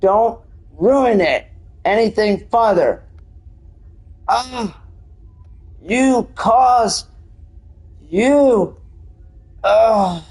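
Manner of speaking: hammed-up anguish, word by word; acted strange voice. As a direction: break it up with drawn-out acted groans. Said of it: "And it says".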